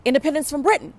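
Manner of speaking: called out high and loud to the room; retold shout of the class answer; yelled answer. Students shouting the answer in class, loud and high. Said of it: "we yell out".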